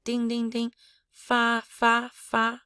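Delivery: "Ding" and "fa" chimed high and level; high bell tone. Shown as a demonstration. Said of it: "high bell tone".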